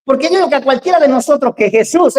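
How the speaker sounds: normal talking voice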